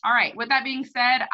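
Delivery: normal talking voice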